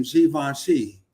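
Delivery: Givenchy said as "zhee-von-shee"; Givenchy as the livestreamer says it, "zhee-von-shee"; quoted pronunciation. Retold or imitated. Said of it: "but it's Givenchy".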